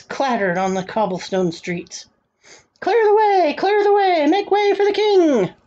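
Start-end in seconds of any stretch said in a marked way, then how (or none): 2.8-5.5 herald's call. A narrator's reading. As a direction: call out high over the crowd to clear a road. Herald clears the way for king.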